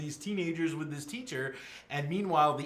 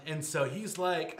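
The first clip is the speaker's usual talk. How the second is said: plain reading voice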